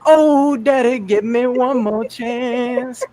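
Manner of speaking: put-on high, slow whiny kid begging; pleading son. Son begging his dad after a smack, high slow whine. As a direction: beg daddy like a whimpering child. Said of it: "He said".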